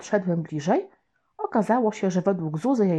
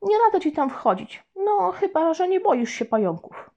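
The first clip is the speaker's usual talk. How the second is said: put-on boy warning; 10 semitones higher than usual. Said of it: "ostrzegłem".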